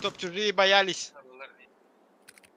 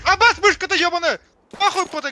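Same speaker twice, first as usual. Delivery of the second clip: angry outburst; 7 semitones higher, 8 dB louder than usual. Real, not acted. Angry at mouse.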